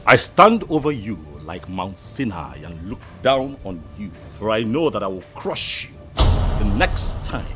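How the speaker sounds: forceful, angry warning; menacing threat. Acted. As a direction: tower over him with your voice. Threatens rival in quarrel.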